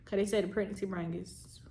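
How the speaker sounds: whispering; whispered aside. Real, not acted. Aside about pregnancy brain.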